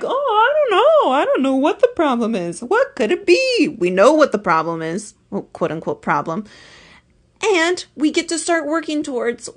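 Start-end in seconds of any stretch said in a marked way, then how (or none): 0.0-3.7 much higher, mock confused whine; high clueless voice. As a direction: play dumb in a high voice. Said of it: "It's not like".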